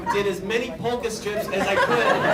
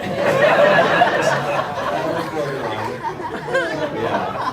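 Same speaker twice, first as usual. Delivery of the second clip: laughing; 2 semitones lower, 6 dB louder, much slower than usual. Real, not acted. Laughing admission about the pun.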